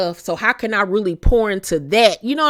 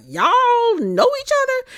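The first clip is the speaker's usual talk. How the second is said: much higher, startled question; surprised Stormy. Retold or imitated. Stormy confronts Martell and Trisha, high and startled. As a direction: ask it like you just caught them out. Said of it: "So Stormy was like".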